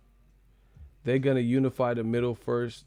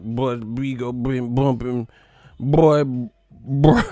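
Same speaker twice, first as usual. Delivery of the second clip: a lightly slowed mock gangster drawl; a hint of mocking a young banger; half-hearted mock tough talk; mock baby blood; 5 dB louder, slower than usual. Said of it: "We got baby bloods in here talking about".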